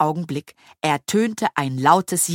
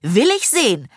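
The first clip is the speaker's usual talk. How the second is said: higher, eager exclamation; bold, pitched-up cry; eager call; 6 semitones higher, 4 dB louder than usual. A narrator's reading. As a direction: call out, keen to look. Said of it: "rief Fee".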